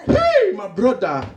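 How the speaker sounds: very high, shrieking African disbelief; African accent. Acted. Friend reacts to death last night, high shocked African accent. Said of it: "Two Africans that haven't seen each other".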